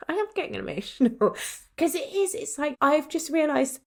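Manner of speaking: fighting back tears through a laugh; real emotion breaking into a laugh; getting emotional. Real, not acted. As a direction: voice lifting high as tears come. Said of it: "I'm gonna get emotional".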